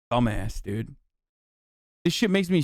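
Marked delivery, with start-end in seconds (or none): 0.1-0.9 low, soft disgusted mutter under the breath; muttered insult. Real, not acted. Streamer muttering at the politician, low and disgusted. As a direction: spit it out quietly with contempt.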